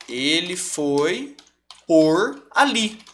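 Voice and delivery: "ele foi por ali" drawn out very slowly, word by word; slow example. Shown as a demonstration. Teacher to students, slowly dictating the example sentence. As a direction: stretch the phrase out so they can hear each word. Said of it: "Então, ó".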